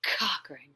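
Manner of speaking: Cochrane whispered, hard k at the start; whispered name. Shown as a demonstration. Teacher whispering her name to show the k sound. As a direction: breathe Cochrane, leaning on the k. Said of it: "Did you hear that?".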